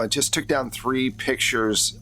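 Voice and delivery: ordinary talk, nothing marked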